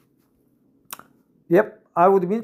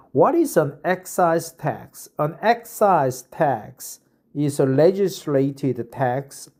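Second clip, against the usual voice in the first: slightly raised, careful read-aloud voice; reading an article aloud; 3 semitones higher, 3 dB louder than usual. Acted.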